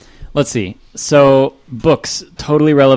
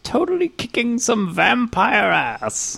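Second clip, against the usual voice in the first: high, slow, solemn orator's voice; grand old statesman's speech, played for laughs; Abraham Lincoln; 11 semitones higher, slower than usual. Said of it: "my week for strange voices".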